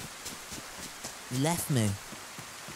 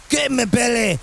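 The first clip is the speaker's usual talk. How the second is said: hammed-up Scottish shout; Scottish accent; 4 semitones higher, 4 dB louder than usual. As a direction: throw yourself into a broad Scottish accent. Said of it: "tap into my Scottish accent".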